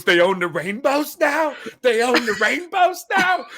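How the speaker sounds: hammed-up astonished father; incredulous dad. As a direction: act floored by the news. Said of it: "my dad goes".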